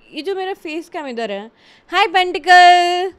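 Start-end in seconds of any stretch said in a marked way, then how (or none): 1.9-3.1 excited greeting. Real, not acted. Greeting a chat viewer excitedly.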